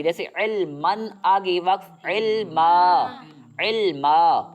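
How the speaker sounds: slow علمن, then علما with a long final a; pausal form demo. Shown as a demonstration. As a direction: read علمن slowly, then stop on علما, stretching the a. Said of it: "کھینچ کر پڑھیں گے".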